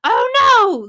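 high, loud hammed-up panic; mock panic. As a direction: play the paramedic freaking out at the scene. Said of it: "they're panicking and freaking out".

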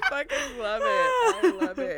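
helpless high-pitched laughter through the words; laughing hard. Real, not acted. Co-host cracking up at the single-another-week joke, laughing hard. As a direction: squeal it out while cracking up.

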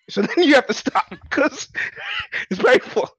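high, loud, breaking into laughter; laughing through words. Real, not acted. Stopping the painful voice for friends, laughing. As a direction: laugh it out after straining.

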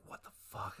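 unvoiced whisper. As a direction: breathe it out in shock. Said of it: "Brett's just like".

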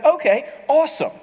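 high, loud burst of approval; pleasantly impressed. Acted. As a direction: pitch leaps up, delighted. Voices pleased first-time church visitors.

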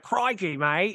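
hammed-up Aussie Crikey; imitating an Australian, high; mock Australian accent. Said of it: "I think I went".

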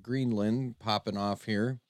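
lively race-calling announcer voice. Acted. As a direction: call the chicken race like live sports. Holds race announcer calls.

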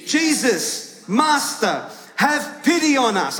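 pleading cry from a distance, performed; loud voice. Shown as a demonstration. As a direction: call out to lead the crowd. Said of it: "join me with a loud voice".

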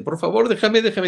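high, whiny begging, almost crying; mock tearful plea. Imitating a member almost crying to stay in the group, in a high whiny plea. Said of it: "casi llorando me decía".

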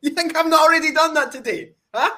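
loud, high challenge shouted back; loud boast. Acted. Champ yelling back at the host who mocked him, loud and high. Wrestler hyping, taunting podcast guest.

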